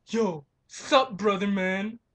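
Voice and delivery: loud, cocky bro voice; sling it like a swaggering dude; bro greeting. Retold or imitated. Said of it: "the way this guy conducted himself".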